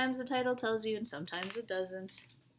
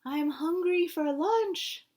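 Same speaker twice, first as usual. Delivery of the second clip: higher, louder, slow knightly declaration; 8 semitones higher, 6 dB louder, slower than usual. Shown as a demonstration. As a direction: announce it like a hungry knight. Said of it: "What does the knight say".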